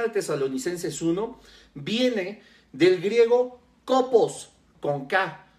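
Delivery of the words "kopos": kopos with a punched first O, higher and louder; kopos, first syllable stressed, falling after. Shown as a demonstration. Preacher teaching a Greek word, kopos stressed on the first O. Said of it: "con acento en la primer O".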